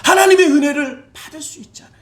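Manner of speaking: ordinary talk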